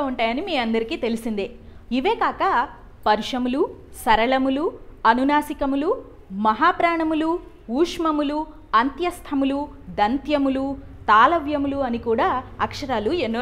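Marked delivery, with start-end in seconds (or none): none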